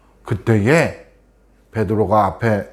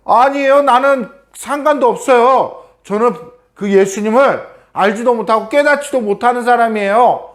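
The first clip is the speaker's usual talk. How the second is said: panicked denial; 10 semitones higher than usual. Acted. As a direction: deny it in a panic. Peter frantically denies Jesus. (여인에게 다그침 받은 베드로, 높고 다급한 부인)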